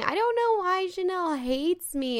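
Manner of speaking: high, drawn-out mock whine; mock crying. Co-host imitating Nicole complaining about Janelle, mock crying. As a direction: imitate a whiny, tearful complaint. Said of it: "Nicole would be crying".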